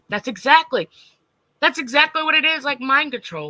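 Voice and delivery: high, loud, fired up; excited agreement. Real, not acted. Agreeing with a chat comment, excited. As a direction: burst out in agreement.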